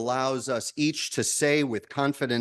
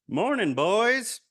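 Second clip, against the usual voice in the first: put-on higher, slow, folksy greeting from a wise old fish; 8 semitones higher, slower than usual. Minister voicing the old fish in a parable, high and slow. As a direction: stretch it out like a kindly elder fish. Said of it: "I'm assuming that's how he sounded".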